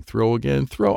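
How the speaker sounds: plain reading voice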